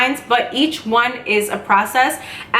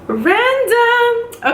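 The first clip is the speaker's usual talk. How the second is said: amused surprise; 12 semitones higher than usual. Real, not acted. Remark on seeing 555 again.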